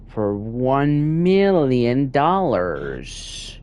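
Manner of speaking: slow, drawn-out villain voice savouring the sum; Doctor Evil. Retold or imitated. Gamer reading a bank file, slowly mimicking a gloating villain. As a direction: stretch out the big number like a gloating villain. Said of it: "Gee whiz, Doctor Evil".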